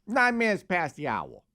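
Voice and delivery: put-on time check in a Brooklyn accent. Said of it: "Did I do it right?".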